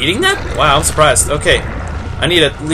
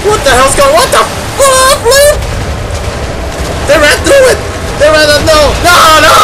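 terrified yelling; 9 semitones higher than usual. Real, not acted. Gamer as monsters run through, terrified high yells. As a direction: scream in disbelief and fear.

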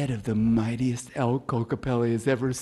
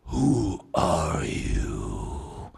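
lower, slow, drawn-out elk voice; giant alien booming voice; 7 semitones lower, much slower than usual. Acted. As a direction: rumble it slowly and menacingly. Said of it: "he says".

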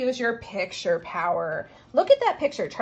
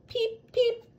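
high squeaky "Peep, peep"; high chick peeps; 11 semitones higher than usual. Acted. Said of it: "twin chicks".

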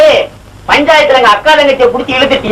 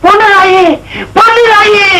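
calling out; 8 semitones higher than usual. Acted. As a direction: call her in from another room, pitched high. Calls the wife to come.

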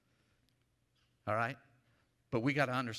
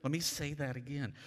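ordinary delivery

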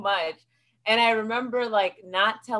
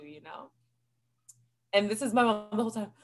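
normal talking voice

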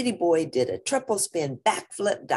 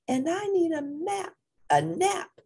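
mild moan; 13 semitones higher than usual. Acted. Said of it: "moaned Mama".

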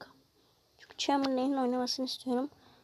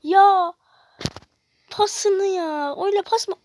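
sinirli bağırış; 4 semitones higher, 7 dB louder, slower than usual. Real, not acted. Hataya sinirli tepki.

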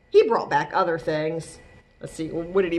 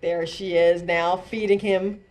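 ordinary delivery, nothing marked

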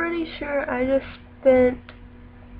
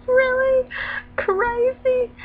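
exasperated high emphasis; 13 semitones higher, 3 dB louder, much slower than usual. Real, not acted. Exasperated about editing.

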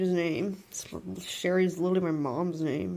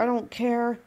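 ordinary delivery

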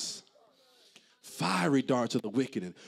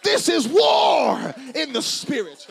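loud, raised shout driven in rhythmic bursts; full-force preaching; 15 dB louder than usual. Real, not acted. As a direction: preach it at the top of your voice.

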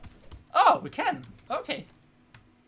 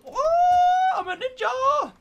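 excited shout; 10 dB louder, much slower than usual. Real, not acted. Excited gamer cheering own move.

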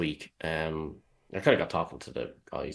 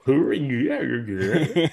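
higher, louder laughing hooray; mock cheer; 8 semitones higher, 9 dB louder, much slower than usual. Real, not acted. Cohost celebrates his name said right, laughing.

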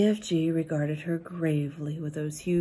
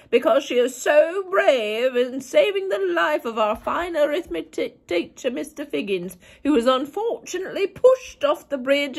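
head teacher; 10 semitones higher, 7 dB louder than usual. Retold or imitated. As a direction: announce to the assembly hall. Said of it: "the head teacher is then standing up and saying".

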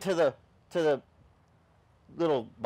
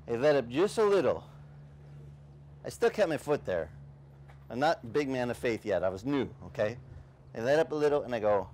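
ordinary talk

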